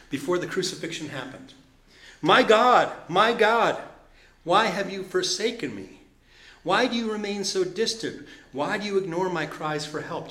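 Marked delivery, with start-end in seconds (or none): 2.2-10.3 higher, louder cry of the psalm; anguished scripture reading. Acted. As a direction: plead the verse out to God.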